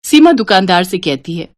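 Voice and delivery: ordinary delivery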